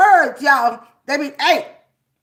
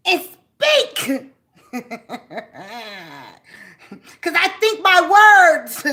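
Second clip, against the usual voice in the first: voice of the anger; 2 semitones higher, 3 dB louder than usual. Acted. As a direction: play the anger bragging it will confuse you.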